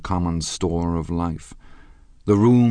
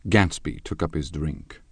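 ordinary delivery

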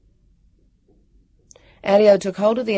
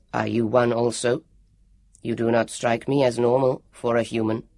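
low, even robot voice; proud android; 4 semitones lower than usual. Acted. Elio telling the warrior he is an android, low and proud. Elio's voice.

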